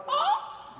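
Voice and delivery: high pain yelp. Retold or imitated. Retelling the soccer ball injury to the crowd, high yelp. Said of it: "I was like".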